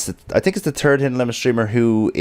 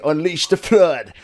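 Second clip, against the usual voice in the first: announcing call; 7 semitones higher than usual. Shown as a demonstration. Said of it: "when I say".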